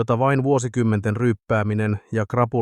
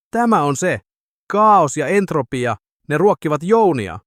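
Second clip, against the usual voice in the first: thrilled; 7 semitones higher, 5 dB louder than usual. Acted. Doctor exulting over his meter, thrilled. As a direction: burst out in mad scientific glee.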